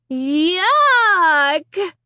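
high, drawn-out yell of disgust; loud disgusted cry. A narrator's reading. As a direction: cry out, grossed out. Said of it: "we both cried in unison".

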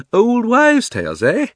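high, loud, slow scoff; forced laugh. A narrator's reading. Uncle dismisses the boy's fairy tales, forced laughter. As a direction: chuckle falsely while dismissing it. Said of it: "with a rather forced laugh".

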